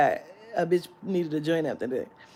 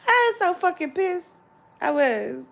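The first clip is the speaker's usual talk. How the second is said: worked-up outburst; 11 semitones higher, 6 dB louder than usual. Real, not acted. Still worked up after laughing.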